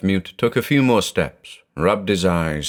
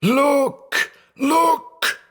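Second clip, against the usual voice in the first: very high, choked cries; strangled cries. Acted. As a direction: cry out while being choked. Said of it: "stifling cries".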